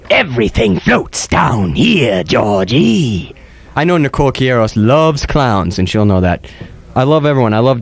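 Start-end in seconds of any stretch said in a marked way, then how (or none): 0.1-3.3 slow, creepy clown voice; slow menacing Tim Curry Pennywise; Pennywise impression. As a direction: drag it out like a sinister clown. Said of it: "Tim Curry".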